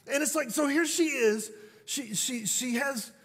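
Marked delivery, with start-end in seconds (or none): none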